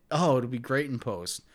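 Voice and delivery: normal talking voice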